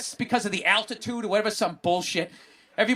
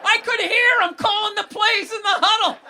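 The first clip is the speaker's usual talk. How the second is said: excited; 13 semitones higher, 5 dB louder than usual. Real, not acted. Excited about tiny stadium.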